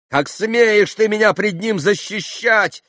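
high, loud furious rebuke; yelling. A narrator's reading. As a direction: yell at him for daring. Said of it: "вопила Грушенька". (яростный вопль)

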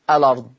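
الأرض said as lard, lam opened, hamza dropped; naql reading. Shown as a demonstration. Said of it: "بقت لام مفتوحة بسبب الهمزة".

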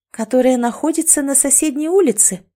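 high, mocking question; sarcastic. A narrator's reading. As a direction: ask it with mock innocence. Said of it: "не удержалась от сарказма Катя".

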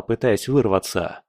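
ordinary delivery

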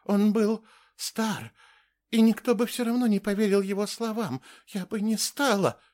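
high voice with its confidence gone; unsure, shaken. A narrator's reading. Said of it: "Голос уже не звучал так уверенно". (неуверенный высокий голос)

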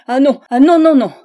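loud, rising cries of no; a husband shouting he wants no doctor; frantic refusal. A narrator's reading.

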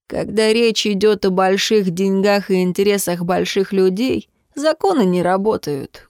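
ordinary delivery, nothing marked

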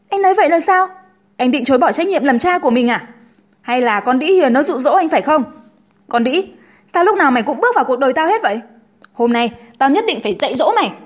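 loud, agitated accusing outburst; furious jilted Linh. A narrator's reading. Linh accuses Hùng and threatens rival, angry outburst. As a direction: lash out at him, then at the rival.

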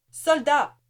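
high, loud angry shout. Acted. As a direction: yell to stop the soldier. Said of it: "cria-t-elle".

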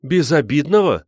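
high, loud incredulous question; indignant. A narrator's reading. Woman challenges the zoologist's word harmless, indignant. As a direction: throw the word back, affronted. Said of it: "возмутилась".

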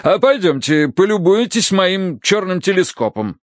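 grand, high-pitched announcement; proclaiming. A narrator's reading. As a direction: proclaim it proudly as the colonel. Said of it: "провозгласил полковник". (торжественно провозглашая)

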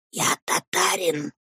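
soft, hushed declaration; whispered boast. A narrator's reading. Headmaster declares himself to the boy, whispering. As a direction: declare it under your breath. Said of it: "мог говорить только шёпотом".

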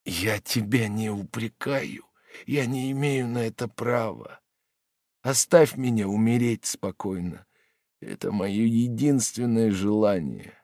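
weak voice. Acted. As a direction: speak with barely any strength left. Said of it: "слабым голосом".

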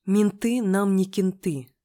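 slow, loud, firm rebuff; cutting retort. A narrator's reading. Friend shuts the cop down, cutting. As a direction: cut him off with deliberate weight. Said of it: "отрезала подруга".

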